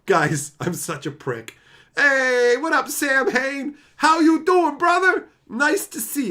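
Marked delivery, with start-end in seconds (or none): 2.0-6.3 loud, high call-out to a friend; excited greeting. Real, not acted. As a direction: hype him up as he walks in, slow on the name. Greets Sam Hain arriving on stream.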